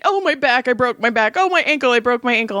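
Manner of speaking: high whiny complaining, oh my back; mock whining son. Mom mocking her son's constant complaints, whiny. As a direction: whine like a hypochondriac teen. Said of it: "he's always hurting".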